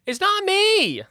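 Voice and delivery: high, loud flustered snake apologizing; high snake apology. Acted. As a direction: squeak out a nervous sorry.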